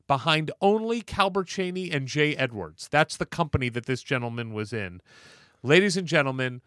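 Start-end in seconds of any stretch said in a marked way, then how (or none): 5.6-6.6 announcer intro. Acted. Podcast host introducing the guest.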